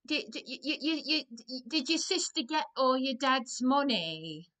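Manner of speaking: loud, high imitation of a nosy northern woman; mock northern accent. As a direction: blurt it out like a gossip stopping her in the street. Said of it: "Like that".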